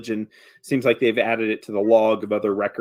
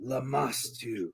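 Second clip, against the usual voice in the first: gravelly voice; 12 dB quieter, slower than usual. Acted. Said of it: "he says it in a gravelly voice".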